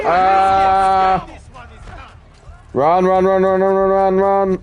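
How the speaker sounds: frantic urging to flee; shrieking jackpot winner. Real, not acted. Panicked escape in game.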